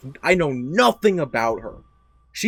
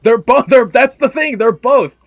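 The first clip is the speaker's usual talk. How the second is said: high, loud insistence; emphatic outburst; 8 semitones higher, 10 dB louder than usual. Real, not acted. Friend insisting to the other that it's both, emphatic outburst. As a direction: burst out with it, pitched right up.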